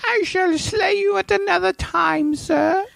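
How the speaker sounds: very high, slow theatrical voice; pretending to be the larping cashier, very high and slow; mock knight. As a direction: ham it up like a courtly knight. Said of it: "we shall let you pass".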